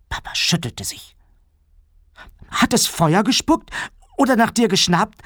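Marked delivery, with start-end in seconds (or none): 2.5-5.2 put-on high-pitched little girl voice; anxious child. A narrator's reading. Hannah hides under the covers, asking high and anxious. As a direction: ask breathlessly eager, pitched way up. Frightened child quizzes Papa.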